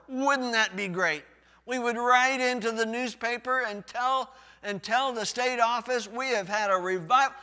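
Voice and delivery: loud, high gushing about revival; hammed-up church crowing over revival; mock excited boast. Pastor voicing church members bragging about revival, loud.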